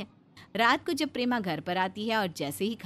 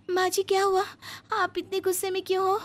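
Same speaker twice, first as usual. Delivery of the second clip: higher, anxious questioning; meek younger daughter-in-law; 11 semitones higher than usual. Acted. Younger bahu runs to her angry mother-in-law, higher and anxious. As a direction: rush in, worried and timid.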